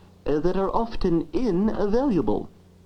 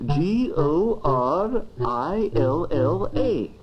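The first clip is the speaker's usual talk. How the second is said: spelled out letter by letter; 4 semitones lower, much slower than usual. Shown as a demonstration. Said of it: "The first word is called the entry word".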